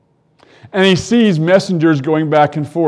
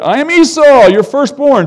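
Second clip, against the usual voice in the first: bold false claim; 8 semitones higher, 6 dB louder than usual. Acted. Jacob claims to be Esau.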